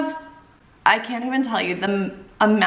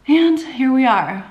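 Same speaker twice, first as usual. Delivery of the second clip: higher, louder, slow mock-dramatic payoff; dramatic reveal; 5 semitones higher, 5 dB louder, slower than usual. Revealing to viewers she ended up with a German, slow dramatic payoff. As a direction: land it like a punchline twist.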